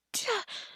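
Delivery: high puff of exertion; heaving sigh under a heavy load; effort sigh. Acted. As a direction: let out a strained, airy huff. Straining to lift an unconscious person.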